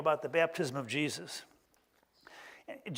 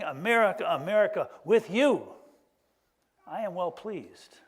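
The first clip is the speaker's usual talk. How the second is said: voice of God; 4 dB louder than usual. Pretend. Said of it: "I can just hear God saying".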